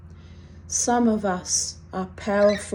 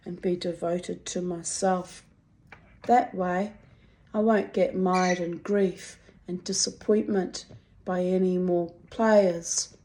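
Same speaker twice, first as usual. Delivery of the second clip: ordinary talk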